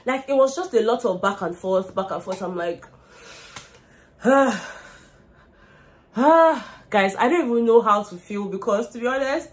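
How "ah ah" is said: weary sigh. Real, not acted. Exhausted by the back and forth.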